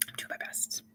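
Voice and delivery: whispered under the breath; a whispered echo to herself. Shown as a demonstration. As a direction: breathe it as a near-silent whisper. Repeats phrase, then whispers it.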